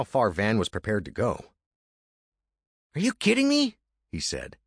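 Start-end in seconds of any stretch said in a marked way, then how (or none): 2.9-3.8 higher, pushy younger brother; Andy's voice. Acted. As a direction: urge him on, high and eager. Andy voiced.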